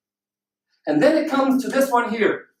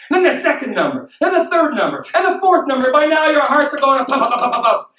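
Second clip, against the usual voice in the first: mounting excitement. Pretend. Speaker reliving each winning number, mounting excitement. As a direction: race through rising suspense like a pounding heart. Building lottery suspense.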